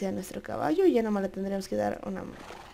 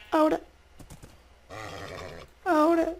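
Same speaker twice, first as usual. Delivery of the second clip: impatient demand; 7 semitones higher than usual. Pretend. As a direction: insist right now. Impatient taming.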